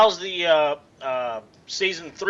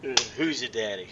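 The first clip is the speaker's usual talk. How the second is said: mock low tease; 6 semitones lower, 7 dB quieter than usual. Teasing about nickname daddy.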